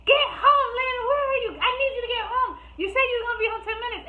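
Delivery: high, loud mock-frantic demand; urgent phone scolding. Friend calls to get her out of it, urgent scolding. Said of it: "She called me at a drop of a dime".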